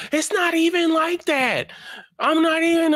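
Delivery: very high whining protest; whiny kid. Retold or imitated. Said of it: "And, like".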